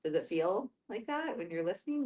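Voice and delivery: ordinary delivery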